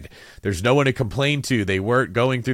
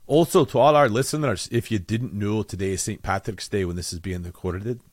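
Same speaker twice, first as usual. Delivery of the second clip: playful put-on Scottish accent meant to be Irish; Scottish accent that comes out of a failed Irish one. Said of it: "this is not an Irish accent, it's a Scottish one".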